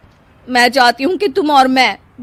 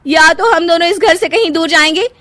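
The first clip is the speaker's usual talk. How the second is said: resentful; 8 semitones higher, 6 dB louder than usual. Acted. Wife complaining to husband.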